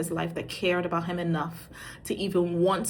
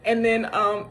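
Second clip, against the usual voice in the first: normal talking voice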